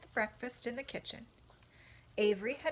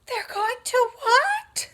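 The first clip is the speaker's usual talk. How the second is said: much higher, much louder crying scream; terrified scream; 15 dB louder than usual. Acted. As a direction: shriek it in horror. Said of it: "screamed Wilbur".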